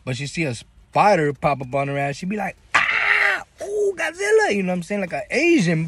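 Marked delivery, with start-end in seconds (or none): 2.7-4.5 very high shrieked Godzilla panic; movie scream. Retold or imitated. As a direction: scream like a fleeing extra in a monster movie. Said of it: "like in the movies".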